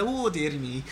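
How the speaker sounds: mild, playful Scottish accent; light-hearted Scottish. Shown as a demonstration. DJ showing co-host his light-hearted Scottish accent, mild Scottish. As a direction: put on a gentle cartoon Scottish lilt. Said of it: "I can do the light-hearted Scottish".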